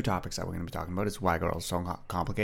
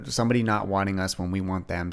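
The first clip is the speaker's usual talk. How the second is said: ordinary delivery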